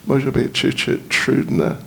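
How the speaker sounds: ordinary talk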